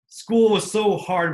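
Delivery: slow exaggerated complaint; mock whining. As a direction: drag it out like a whiny kid. Mock whining about school.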